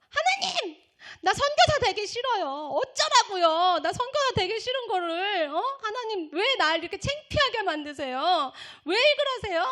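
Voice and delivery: high, loud protest to God; angry yelling. Retold or imitated. Humiliated student under the blanket to God, angry yelling. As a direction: cry out in acted, tearful outrage. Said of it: "소리를 질렀어요".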